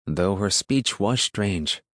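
plain reading voice

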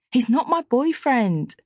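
high, slow indignant denial; high protest. Acted. Tia denies boyfriend.

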